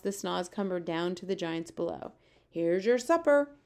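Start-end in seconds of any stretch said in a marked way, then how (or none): 2.5-3.6 louder, higher jeering shout. Acted. BFG shouting down at the giants in the pit. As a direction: hurl it down into the pit. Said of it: "he shouted".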